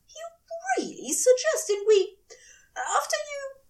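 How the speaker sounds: high, sputtering disbelief; the angel sputters, half crying at the idea; spluttering angel. A narrator's reading. Angel sputtering in disbelief at his demon lover, voice high. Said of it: "he spluttered".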